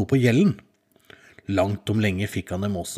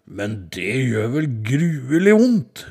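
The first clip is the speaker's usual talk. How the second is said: troll voice; 2 semitones higher, 4 dB louder, much slower than usual. Acted. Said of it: "spurte trollet".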